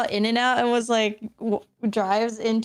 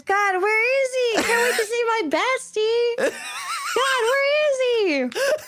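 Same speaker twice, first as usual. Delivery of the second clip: mock eager search; 11 semitones higher than usual. Acting out searching for bestie.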